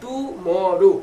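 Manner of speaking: "টুমরো" drawn out in three slow parts; three syllables. Shown as a demonstration. Teacher showing a three-syllable word, "টুমরো" broken up slowly. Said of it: "তিনবারে উচ্চারিত হবে".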